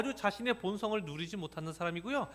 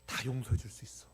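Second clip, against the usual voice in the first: whisper; 7 semitones lower, 5 dB quieter than usual. Retold or imitated. Inner voice ready to forgive.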